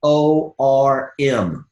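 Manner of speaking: slow letter by letter; spelled out slowly. Shown as a demonstration. Coach spelling the acronym for notes, slow. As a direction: dictate each letter for notes. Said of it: "Write this down".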